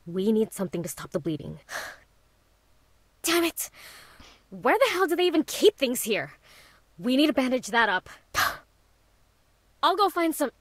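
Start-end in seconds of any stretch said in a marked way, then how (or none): 3.2-8.1 raised, high-pitched frantic outburst; loud and frustrated, scrambling for help; panicked urgency. Acted. Friend hunts for bandages for the bleeding hand, frantic. 9.8-10.5 high, loud and hurried offer; panicked urgency. Acted. Friend rushes to find something for the cut, frantic. Rushing off for bandages.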